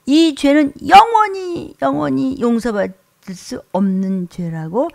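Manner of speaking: slow, high, loud shout. Acted. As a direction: thunder it out slowly from the pulpit. 설교 절정에서 외침. (높고 크게 천천히 외치기)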